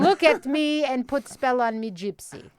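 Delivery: put-on slow superstitious warning in a Russian accent; hammed-up Russian accent, mom warning off a curse. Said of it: "That's just a basic Russian accent".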